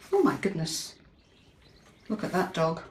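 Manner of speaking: ordinary delivery, nothing marked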